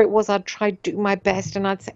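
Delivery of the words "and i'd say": ordinary talk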